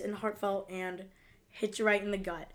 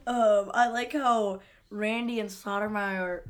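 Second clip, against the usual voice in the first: ordinary talk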